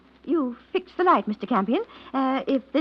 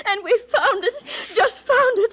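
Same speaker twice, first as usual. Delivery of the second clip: high, louder, near-tearful joy; overjoyed; 10 semitones higher, 4 dB louder than usual. Acted. Wife to husband over the money, tearful joy. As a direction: gush it out, can't believe it.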